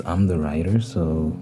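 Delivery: ordinary talk, nothing marked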